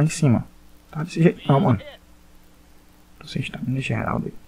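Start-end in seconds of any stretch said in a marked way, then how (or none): 3.2-4.4 whispered. Real, not acted. Low stamina in fight.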